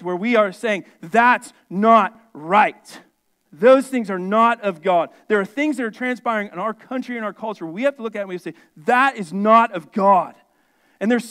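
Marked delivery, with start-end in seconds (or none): 1.0-5.1 loud, slow, deliberate declaration; emphatic protest. Acted. Preacher voicing believers objecting to wickedness, emphatic. 8.8-10.3 loud, slow, deliberate pronouncement; emphatic protest. Acted. Preacher telling congregation what to say about culture, emphatic. Voicing firm moral protest.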